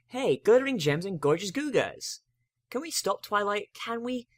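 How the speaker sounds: put-on a baby dragon begging to stop at the gem shop, excited; eager Spike begging. A narrator's reading. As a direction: beg to stop like an excited kid. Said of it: "change of topic".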